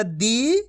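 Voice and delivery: दी stretched long, rising in pitch. Shown as a demonstration. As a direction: draw out दी on an upward glide. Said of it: "खींच खींच के पढ़ना है".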